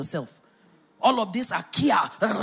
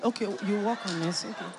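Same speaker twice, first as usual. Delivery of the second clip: soft typing mutter; 4 semitones lower, 8 dB quieter than usual. Retold or imitated. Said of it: "I'll be typing it".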